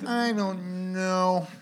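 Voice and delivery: very slow, high put-on drawl; millennial impression. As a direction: stretch it out in a silly voice. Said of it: "Was that your millennial impression?".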